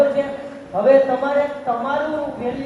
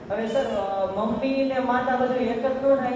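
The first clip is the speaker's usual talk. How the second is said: ordinary delivery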